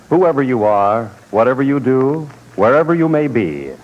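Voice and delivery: ordinary delivery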